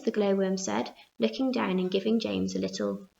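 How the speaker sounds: ordinary delivery, nothing marked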